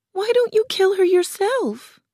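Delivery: put-on very high, loud girlish wail; tearful challenge. A narrator's reading. Crying Dorothy challenges Oz, tearful and loud. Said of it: "Dorothy began to cry".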